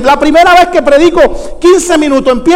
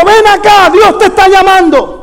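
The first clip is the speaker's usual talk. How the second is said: calling out; 7 semitones higher than usual. Retold or imitated. Preacher calls man back.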